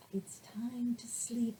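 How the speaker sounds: hushed, slow whisper; soft bedtime whisper. Acted. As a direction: whisper it gently.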